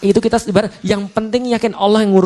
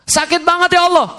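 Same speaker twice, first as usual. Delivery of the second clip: mock labour pain; 11 semitones higher than usual. Imitating a woman in labour.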